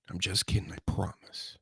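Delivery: breathy whispered take-back, no voice; whispering. Acted. Whispering to listeners that the slap threat was a joke. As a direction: whisper it like a sly aside.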